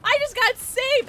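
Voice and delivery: thrilled at the rescue; excited. Real, not acted. Game moment, loud high.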